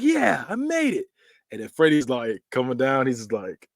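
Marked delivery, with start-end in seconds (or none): 0.0-1.0 high, slow triumphant cheer; mock relief. The guy cheering his parachute, high and slow mock triumph. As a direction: celebrate the parachute opening. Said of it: "He's like".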